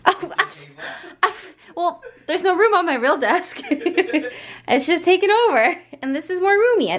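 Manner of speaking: flustered laughing, high and loud. Real, not acted. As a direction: laugh through it, caught out. Caught out, laughing, much higher.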